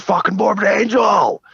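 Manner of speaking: high, drawn-out fan-style yell; retold shout. Singer hailing fans in band shirts, shouted. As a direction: holler the band's name out long and high.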